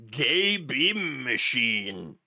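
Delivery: very slow, drawn-out mocking quote; mock dramatic emphasis. Host quoting a podcaster's scanner fear, drawn out mockingly. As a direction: stretch each word out for ridicule. Mocking retelling, savouring the absurd phrase.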